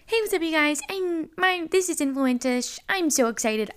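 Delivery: mock her own bubbly intro; perky podcast host. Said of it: "I'm like".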